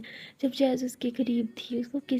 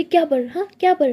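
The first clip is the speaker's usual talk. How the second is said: loud, higher cutting retort; angry retort; 5 semitones higher, 7 dB louder than usual. Acted. Tara cuts her girlfriend off mid-quarrel, loud angry retort. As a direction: throw her word back at her, furious.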